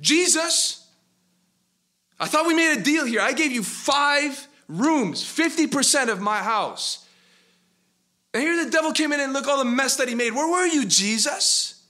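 high, loud furious accusation; angry, frustrated. Acted. Man yelling at Jesus about their broken deal, furious. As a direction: yell at him, betrayed by the deal. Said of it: "He was angry, frustrated".

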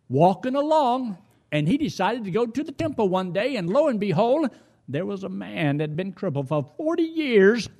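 high, ringing sermon delivery; preaching. Real, not acted.

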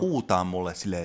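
ordinary talk, nothing marked

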